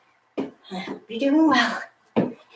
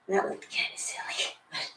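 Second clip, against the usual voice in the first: whispered aside; 13 dB quieter than usual. Real, not acted. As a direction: breathe it out under the breath. Muttered aside after laughing.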